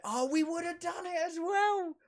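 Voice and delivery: high-pitched excited outburst; excited squeal. Real, not acted. Excited realization mid-game.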